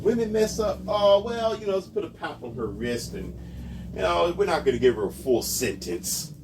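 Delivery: high, mocking imitation of lenient critics; sarcastic mock voice. Vlogger mocking lenient news coverage, high sarcastic voice. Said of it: "being sarcastic".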